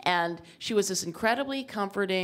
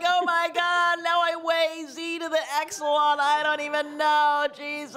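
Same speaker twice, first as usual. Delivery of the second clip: high, loud despairing cry; comic sobbing over the scale; tearful wail; 8 semitones higher, 4 dB louder than usual. Retold or imitated. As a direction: wail it through tears. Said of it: "I'm like".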